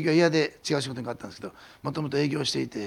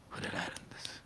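hushed whisper; 15 dB quieter than usual. Acted. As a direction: let it fall to a breath. Preacher stressing Spirit's presence.

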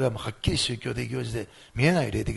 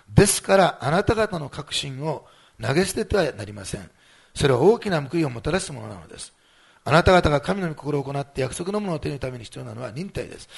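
scripture reading; 5 dB louder than usual. Acted. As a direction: read the verse out deliberately to the church. Reads Hebrews aloud to congregation.

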